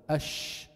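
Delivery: أش with a long, airy sh; spreading shin. Shown as a demonstration. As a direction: let the breath spread through the ش of أش. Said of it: "انتشار صوت الشين".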